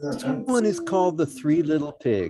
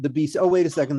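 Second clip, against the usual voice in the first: ordinary delivery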